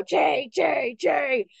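high imitated jay call; blue jay call. Shown as a demonstration. Birding teacher imitating a blue jay call for students. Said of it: "they simply go".

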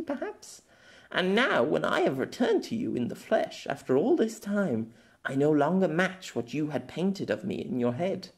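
gently needling him; young girl. Acted. Girl challenging Pierre's memory of her, knowing.

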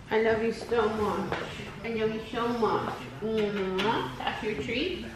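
soft cooing to the dogs. Real, not acted. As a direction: gently coo it, almost a whisper. Cooing to dogs before leaving.

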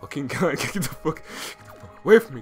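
usual speaking voice

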